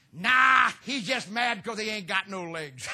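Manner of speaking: booming careless put-down, half laughing; loud blurted retort. Retold or imitated. Old mentor loudly mocking the preacher to the pastor's wife.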